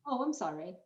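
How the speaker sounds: ordinary talk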